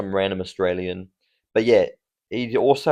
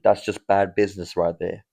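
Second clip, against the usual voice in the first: ordinary delivery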